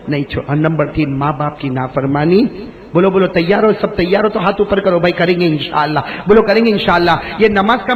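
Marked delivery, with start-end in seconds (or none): none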